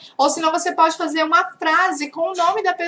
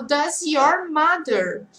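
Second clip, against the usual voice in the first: slow model phrase; much slower than usual. Shown as a demonstration. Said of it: "agora eu falo".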